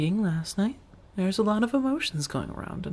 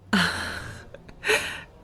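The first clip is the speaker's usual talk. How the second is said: breathy laugh; 3 semitones higher than usual. Acted. Laughs at listener's shopping.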